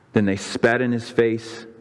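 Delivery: ordinary delivery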